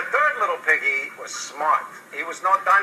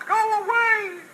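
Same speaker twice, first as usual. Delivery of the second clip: squeaky pig. Acted. Pig refuses the wolf.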